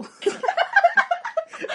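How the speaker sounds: high shrieking burst of laughter; loud squeal of laughter. Real, not acted. Laughing at her own story.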